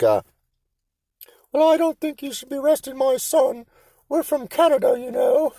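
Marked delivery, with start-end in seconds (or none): 1.5-5.5 put-on very high, indignant old-lady voice; old English lady. Mom telling the cop not to arrest her son, very high and prim. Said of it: "she's like".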